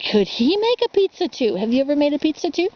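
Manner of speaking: ordinary delivery, nothing marked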